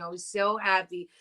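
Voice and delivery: usual speaking voice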